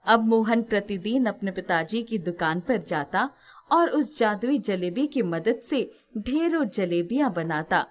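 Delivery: ordinary delivery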